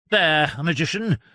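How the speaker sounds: loud gloating cry; triumphant. Acted. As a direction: crow over the magician. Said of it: "cried Doctor John triumphantly".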